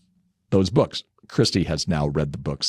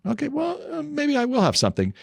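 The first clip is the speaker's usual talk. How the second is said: mock coy. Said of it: "where I'll go".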